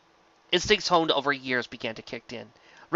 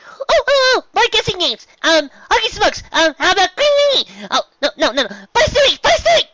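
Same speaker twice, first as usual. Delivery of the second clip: very high, loud cartoonish squeal; silly squeaky voice; 9 dB louder than usual. A narrator's reading. The narrator riffs in a giddy voice, very high cartoonish squeal.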